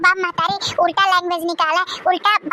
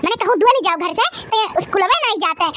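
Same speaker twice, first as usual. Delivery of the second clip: ordinary talk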